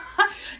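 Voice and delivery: short laugh. Real, not acted. Laughs at her own rating.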